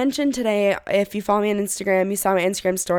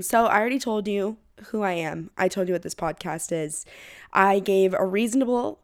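usual speaking voice

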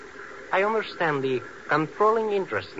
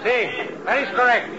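calling out orders; 8 semitones higher than usual. Acted. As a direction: direct the crew from a distance. Engineer calls orders to workers.

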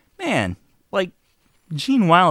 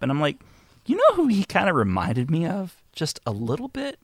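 ordinary talk

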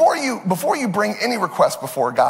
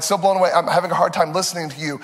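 ordinary talk

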